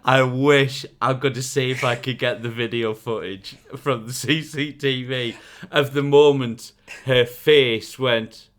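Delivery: ordinary talk, nothing marked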